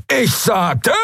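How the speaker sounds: high-pitched, cut-off yell; high screeched outburst. A narrator's reading. Halbhorn insisting amid the roaring, screeched. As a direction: screech it out in protest. Halbhorn snaps back after the roar.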